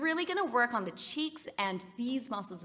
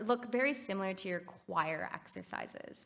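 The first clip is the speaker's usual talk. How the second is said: ordinary delivery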